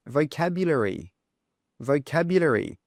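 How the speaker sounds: "vocabulary" stressed on CAB; stress on second syllable. Shown as a demonstration. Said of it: "stress here is on the second sound".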